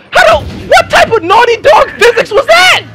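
high cracking shout of disbelief; outraged yelp. Real, not acted. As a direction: scream it at the screen. Outraged at game physics.

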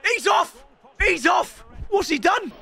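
high, startled exclamations; astonished outburst. Real, not acted. Gamer seeing a red card, high astonishment. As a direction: cry out high in disbelief.

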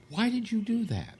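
high put-on bear voice, puzzled; a goofy cartoon bear asking why; talking bear. Bear asks the hunter why, high silly voice.

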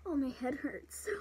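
pained whisper. Real, not acted. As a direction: whisper it, head throbbing. After the fall.

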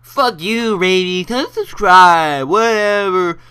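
high, loud, drawn-out mocking whine; mock the complainers, stretched out and high; mock angry viewers. Imagining viewers' reaction to his topic, high exaggerated mockery. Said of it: "people being like".

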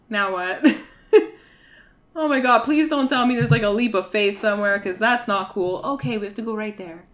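normal talking voice

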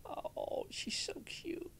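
whispered, fond aside; soft whisper over a cute scene. Real, not acted. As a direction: hush it like a secret.

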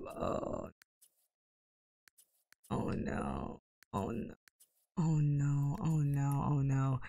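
very soft, low repeated murmur; hushed dismay. Real, not acted. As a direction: let dread sink in under your breath. Dismayed as kids age up.

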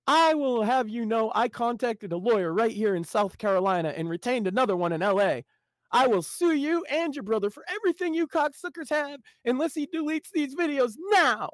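high, shrieking legal threat; hysterical Jimmy. Acted. Jimmy threatening his editor, high and hysterical. Jimmy's angry message read aloud.

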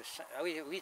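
ordinary delivery

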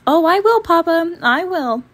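high, loud eager promise. Acted. Sister promises Papa eagerly as she leaves, high and bright. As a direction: bubble over with a yes. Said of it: "she said".

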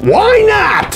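high put-on squeak; mock disbelief. Host teasing viewers who skip the podcast, squeaky mock shock. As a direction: pretend shock that they haven't listened.

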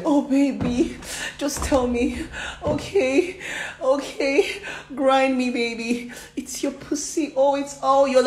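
high, whimpering endearments; retold lovers' cooing. Lovers reconciling in her retelling, high whimpering. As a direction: act out breathy, pleading surrender.

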